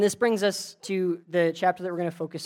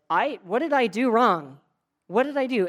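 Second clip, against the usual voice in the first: higher, louder protest of innocence; retold bewildered panic; mock freak-out; 5 semitones higher, 6 dB louder than usual. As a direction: act out being freaked out, voice pitched up. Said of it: "He's, like, freaked out".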